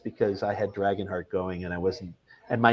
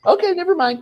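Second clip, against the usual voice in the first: mock caller; 7 dB louder than usual. Caller abruptly drops the request.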